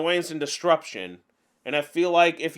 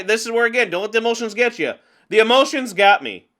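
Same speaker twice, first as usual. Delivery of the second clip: ordinary talk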